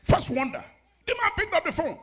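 loud declaration, high; preaching at full force. Acted. Pastor declaring a blessing on the church. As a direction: proclaim it over them.

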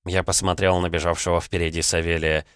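ordinary delivery

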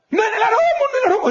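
high shrill mock scream of an angry woman, imitating her; mock furious woman screaming. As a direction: shriek it like a furious woman bursting in. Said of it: "Créanme, una loca furiosa". (imitando a una mujer furiosa gritando, voz mucho más aguda)

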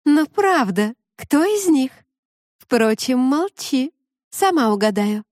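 high playful mermaid voice. Acted. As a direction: coax it playfully, pitched high.

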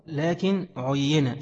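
لكن عين read very slowly with every vowel sounded, عين as ʕayyana; careful vowelled reading. Shown as a demonstration. Teacher to students, sounding out the vowels very slowly. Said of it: "شكلوها صح عشان تنطقوها صح".

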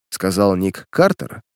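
plain reading voice

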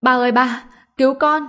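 high, loud cry for help; loud panicked scream. Acted. Boy fleeing a ghost screams for his father, high panicked shout. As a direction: scream for rescue. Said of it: "vừa hét lớn".

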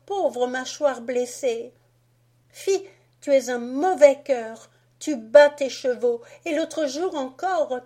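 put-on high, loud tearful girl's reproach; Marie scolding. Marie to Fritz defending the nutcracker, high loud reproach. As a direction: scold him like a wounded little sister.

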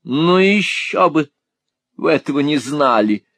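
parry him with mock sarcasm; tart retort. A narrator's reading. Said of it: "парировала мисс Лакриви". (язвительно парирует)

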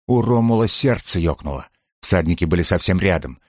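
ordinary delivery, nothing marked

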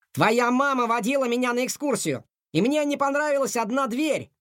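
high-pitched, hurried-sounding excitement; explaining on the run. A narrator's reading. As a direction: explain excitedly while running. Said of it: "объяснил на бегу Котовский". (взволнованное объяснение на бегу, высоким голосом)

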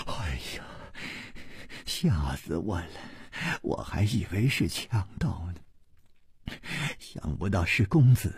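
soft, breathless gasping after a fright; out of breath. A narrator's reading. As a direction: pant it out weakly, catching your breath. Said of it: "喘着气说". (受惊后喘着气轻声说)

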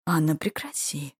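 plain reading voice

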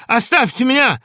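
high, loud furious cry; frantic shout. A narrator's reading. Doctor driving off his visitors, frantic shout. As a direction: scream it beside yourself with rage. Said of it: "крикнул он не своим голосом".

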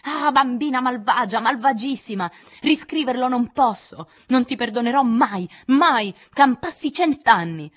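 urlo furioso e disperato, più alto; dolore e rabbia. Acted. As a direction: esplode urlando, lento alla fine. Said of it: "gridando in un'esplosione di dolore e di rabbia". (Jo urla ad Amy in un'esplosione di dolore e rabbia)

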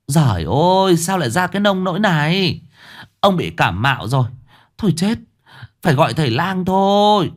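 high, fast, frightened wife's voice; a wife in alarm over her sick husband; panicked wife. Acted. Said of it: "Ấy là tiếng của bà Lục". (giọng bà vợ hoảng hốt, cao và nhanh)

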